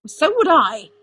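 loud, high comeback; heated retort. A narrator's reading. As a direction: fire it back emphatically. Arthur retorts in the quarrel.

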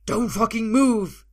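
high, drawn-out furious yell; explosive hostage-taker's shout; screaming. A narrator's reading. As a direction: scream a warning. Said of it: "Alvar screams".

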